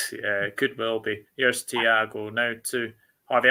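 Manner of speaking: ordinary delivery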